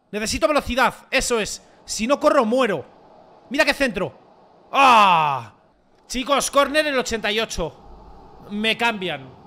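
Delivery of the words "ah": loud, falling exclamation; loud cry "ah". Real, not acted. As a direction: let out a sudden loud "aah". Reacting to missed cross.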